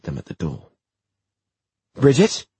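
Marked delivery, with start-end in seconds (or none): 1.9-2.5 loud high call into the house. Acted. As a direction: call her name anxiously. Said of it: "Tessa called".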